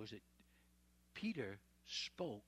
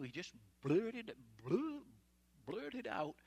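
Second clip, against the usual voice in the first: excited blurting; 6 semitones higher, 3 dB louder, slower than usual. Acted. Preacher mimics Peter blurting.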